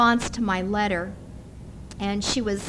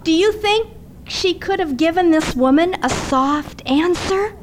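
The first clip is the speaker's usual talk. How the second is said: normal talking voice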